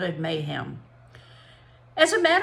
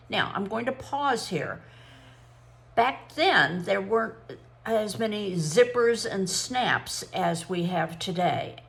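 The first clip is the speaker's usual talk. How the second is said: ordinary delivery, nothing marked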